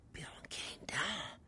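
slow, whispered disbelief; stunned whisper. Real, not acted. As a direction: barely breathe the words. Watching the tower collapse.

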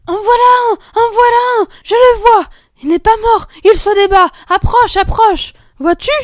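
très aiguë et criée; s'écrier à pleine voix en découvrant l'animal; cri d'enfant excitée. Acted. Fillettes découvrent le hérisson vivant. (fillette criant d'excitation)

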